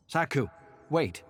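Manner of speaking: high, loud call to stop her. A narrator's reading. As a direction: shout her name to halt her. Character calls out to stop her.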